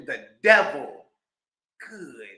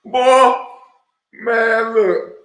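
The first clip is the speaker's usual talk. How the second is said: loud, high exclaiming; loud outburst; 8 semitones higher, 11 dB louder, much slower than usual. Real, not acted. Host reacting to the clip, loud outburst. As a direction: burst out at what he heard.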